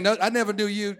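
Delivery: usual speaking voice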